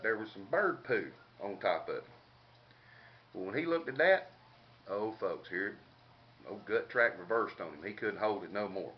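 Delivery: ordinary talk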